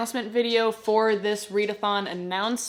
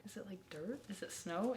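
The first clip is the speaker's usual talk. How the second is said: whispering; 2 semitones lower, 19 dB quieter than usual. Real, not acted. Unsure guess.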